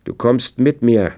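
laut und drängend, etwas höher; er packt sie und befiehlt heftig; wild. A narrator's reading. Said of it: "sagte er wild". (Arzt zwingt seine Frau wütend mitzukommen)

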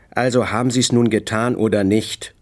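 plain reading voice

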